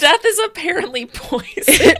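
laughing. Real, not acted. Laughing outrage.

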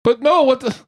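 high, hurt vampire whine; whiny vampire protest. Acted.

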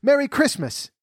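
high-pitched festive cry; exuberant greeting. A narrator's reading. Character wishing someone Merry Christmas, exuberant. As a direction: call out a merry greeting, voice leaping up.

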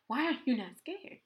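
high-pitched, incredulous worried questions; mock scared questioning. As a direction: act out being baffled that he isn't scared. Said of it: "we just all scared".

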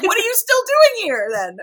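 high, loud mock disbelief; incredulous daughter. Daughter challenging Louis for staying, high-pitched mock exasperation. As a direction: imitate an exasperated shriek of a question. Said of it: "She's like".

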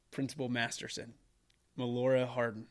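usual speaking voice

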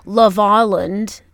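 imitated Australian "Love Island" with the weight on "Island"; stress on Island. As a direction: draw out "Love Island", leaning on the second word. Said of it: "the emphasis on love, but they go".

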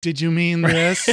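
slow, smug search-engine voice; mock Google talking down to you; condescending. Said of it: "condescending Google is like".

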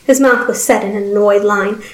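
plain reading voice

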